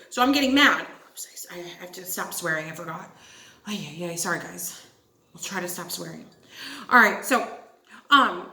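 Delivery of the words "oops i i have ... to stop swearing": low, soft self-scolding; real, hushed little apology to the listeners; sheepish aside. Real, not acted. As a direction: apologize quietly under your breath, dropped well down.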